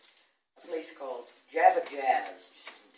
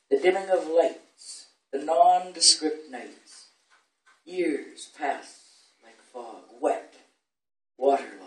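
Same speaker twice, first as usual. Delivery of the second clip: deliberate verse reading, strong stresses and pauses; poetry recitation; 3 semitones higher, 7 dB louder, slower than usual. Acted. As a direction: open phrases strongly, sink to a hushed close. Recites own poem to audience.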